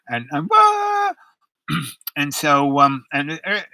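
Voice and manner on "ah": a sudden, very high, loud mock wail. Husband crying by the window, in a loud falsetto wail. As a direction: let out a high cartoon sob.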